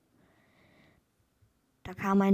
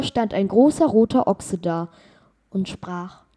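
ordinary delivery, nothing marked

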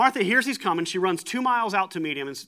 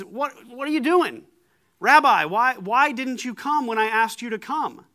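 loud, high-pitched demand for an explanation; fearless, direct; 6 semitones higher, 6 dB louder than usual. Acted. Martha questions the rabbi in public, bold and direct. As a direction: challenge him boldly, voice raised. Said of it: "she does not fear talking to Jesus".

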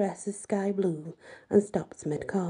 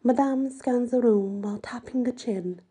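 plain reading voice

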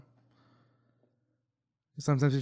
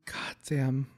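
whispered; 4 dB quieter than usual. Real, not acted. Whispered reaction to scene.

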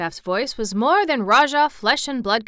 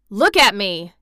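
loud, defiant order; forceful proud demand; flung-out challenge; 8 dB louder than usual. A narrator's reading. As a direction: fling it up defiantly. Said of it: "he flung up at Ben Weatherstaff".

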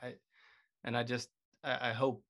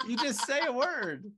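amused; 12 semitones higher, 7 dB louder, slower than usual. Real, not acted. Explaining game while laughing.

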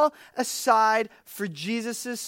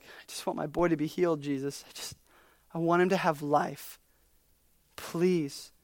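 quiet begging; 5 semitones lower, 7 dB quieter than usual. Retold or imitated. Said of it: "Dad's like".

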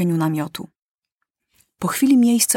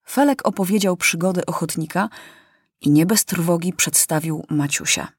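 ordinary delivery, nothing marked

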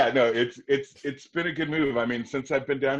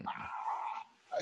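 breathy gasp; 11 dB quieter than usual. Retold or imitated. Breathless reaction to news.

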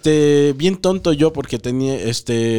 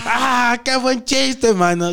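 mock laughing customer; 9 semitones higher, much slower than usual. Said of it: "saca una sonrisa".

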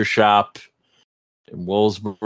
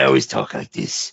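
deep gravelly-free low Batman growl, much lower; joking Batman impression to a friend; 12 semitones lower than usual. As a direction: drop way down into a mock Batman voice. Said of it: "you sound like Batman".

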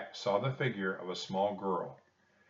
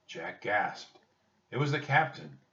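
ordinary delivery, nothing marked

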